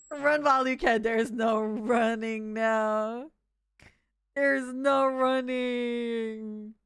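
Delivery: slow, drawn-out hammy villain threat; slow theatrical villain menace. Pretend. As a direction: gloat it like a cartoon villain. Mock villain holding chat hostage.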